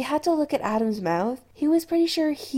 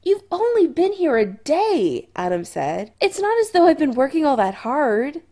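teasing; 6 semitones higher, 5 dB louder than usual. A narrator's reading. Adam teasing Chris.

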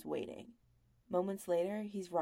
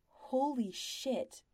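stunned thought; 6 semitones higher, much slower than usual. A narrator's reading. Brendan thinks.

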